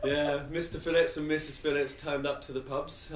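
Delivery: giggly and delighted; laughing. Real, not acted. Delighted story of meeting Mrs Phillips.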